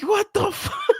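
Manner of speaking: high squeal breaking with laughter; mad, retold. Son mad at mom over posters, retold through laughter. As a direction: reenact outrage while cracking up. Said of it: "I was fucking so mad".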